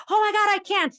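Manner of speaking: hammed-up protest; panicked client. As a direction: whine it like the frazzled client.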